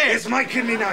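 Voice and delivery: high, heated comeback; defiant retort. Acted. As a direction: claim it from your brother. Brother snaps back in quarrel.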